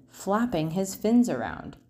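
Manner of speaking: ordinary delivery, nothing marked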